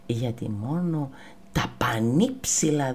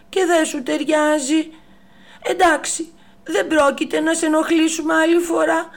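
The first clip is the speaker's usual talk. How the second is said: high, loud, quick monkey voice; monkey reply; 11 semitones higher, 8 dB louder than usual. Acted. The little monkey answering the strict giraffe, high and fast. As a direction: warn her cheekily. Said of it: "της απάντησε".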